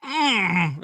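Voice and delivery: loud, high frustrated "mm"; hissy-fit grunt. Acted. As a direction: grunt like a sulky orc throwing a fit. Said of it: "in a hissy fit-like manner".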